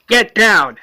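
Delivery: loud, high acted shout; screamed warning. Woman warns guard of explosives, screamed. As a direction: yell a warning like the fleeing woman. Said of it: "She screams to".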